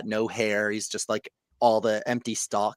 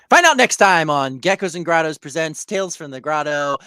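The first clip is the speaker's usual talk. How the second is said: show outro; 5 semitones higher, 8 dB louder than usual. Acted. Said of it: "episode sign-off".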